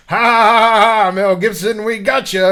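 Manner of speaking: high, loud hammed-up cackle; mock gloating laugh. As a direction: crow over the pranked victim. Retold prank reveal with laughter.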